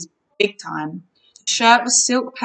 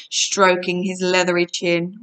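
plain reading voice

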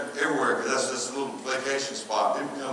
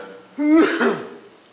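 high, loud burst of laughter; short whooping laugh; 11 semitones higher, 8 dB louder than usual. Real, not acted. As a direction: let out a sudden high laugh. Laughs after battery aside.

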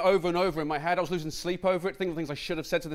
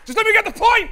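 much higher and louder, frantic; exasperated yell; 13 dB louder than usual. Real, not acted. Comedian losing patience with the heckler, frantic yell. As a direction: snap at the heckler at full volume.

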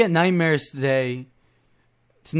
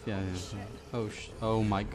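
usual speaking voice

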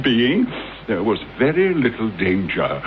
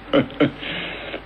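soft breathy snicker; 4 semitones higher, 5 dB quieter than usual. Acted. Mocking Holmes.

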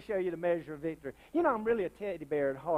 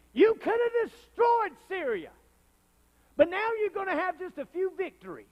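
angry prophet; 12 semitones higher, 5 dB louder than usual. A narrator's reading. Prophet rebuking the king, high and loud. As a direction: scold the king for stopping short. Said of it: "the man of God was angry with him".

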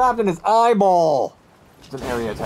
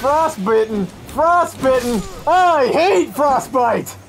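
higher-pitched cry of frustration; exasperated outburst; 5 semitones higher, 3 dB louder, slower than usual. Real, not acted. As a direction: rail at the game, stretching out the last words. Exasperated outburst at getting frostbitten.

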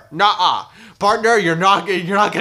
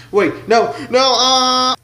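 mock panic; 8 semitones higher, 3 dB louder, much slower than usual. Scared before the pain train.

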